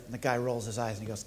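ordinary talk

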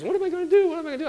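high, helpless mock wail of a desperate parent; panicky parent. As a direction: whine it like a cornered parent. Parent held captive by toddler.

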